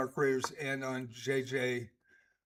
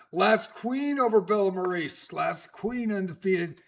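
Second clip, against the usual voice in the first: higher, louder results announcement; live play-by-play; 5 semitones higher, 8 dB louder, slower than usual. Real, not acted. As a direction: punch the players' names like a match commentator.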